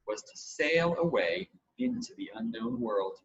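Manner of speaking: usual speaking voice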